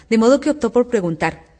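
ordinary delivery

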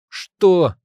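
high, loud yell; shouted question. A narrator's reading. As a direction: shout over the noise. Can't hear over battle.

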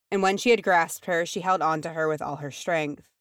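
usual speaking voice

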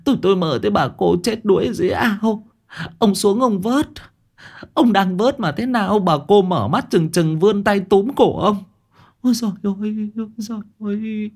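high-voiced shaken woman recounting a nightmare; wife tells husband her nightmare, high and frightened. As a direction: tell him the dream still trembling.

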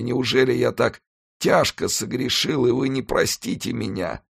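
high, pained pleading voice; anguished dying executioner. Acted. Dying executioner confesses to the monk, high and anguished.

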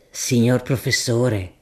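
a deferential, earthy shopkeeper making his offer; grocer Gaudenzio. Acted. Droghiere Gaudenzio voiced.

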